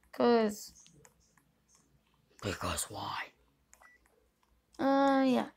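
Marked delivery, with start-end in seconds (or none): 2.4-3.3 "Because why?" whispered, voiceless and soft. Shown as a demonstration. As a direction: hush it down to a breathy secret. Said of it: "Because why? (repeated)".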